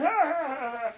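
high, forced ha-ha-ha; scornful laugh. Acted. Bud scoffs at the girlfriend jibe, scornful laugh. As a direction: laugh off the accusation derisively.

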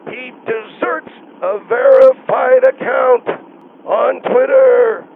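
loud, slow, hammering each word; drawn-out indignant shout; exasperated outburst. Acted. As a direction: belt it out word by word. Exasperated protest over quitting Twitter.

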